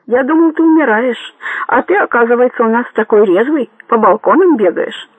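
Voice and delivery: jovial taunt called down from above; cheerful call. A narrator's reading. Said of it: "весело крикнул он вниз".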